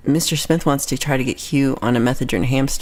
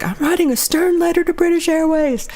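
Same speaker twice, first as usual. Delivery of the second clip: high-pitched, huffy declaration; hammed-up indignant squeak; mock indignation; 13 semitones higher than usual. Retold indignant threat to complain.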